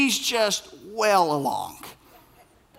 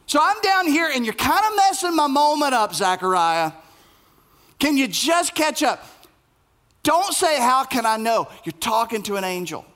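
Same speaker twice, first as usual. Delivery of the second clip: witty, sarcastic angel; 8 semitones higher than usual. Acted. A sarcastic angel scolds Zechariah, higher and exasperated. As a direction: scold the old priest for doubting. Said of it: "I love a witty, sarcastic angel".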